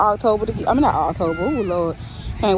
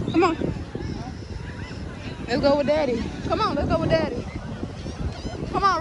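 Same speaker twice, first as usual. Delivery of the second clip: higher, sing-song coaxing to a little one; 7 semitones higher, 5 dB quieter than usual. Real, not acted. Mother urging her baby toward daddy, lilting baby talk.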